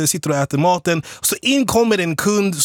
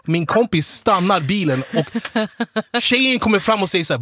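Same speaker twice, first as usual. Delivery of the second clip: ordinary talk, nothing marked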